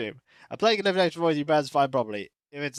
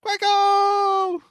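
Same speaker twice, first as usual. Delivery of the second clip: high, loud, stretched catchphrase yell; sustained cartoon holler; drawn-out shout; 8 dB louder than usual. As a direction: call it out long and high. Acted calling out.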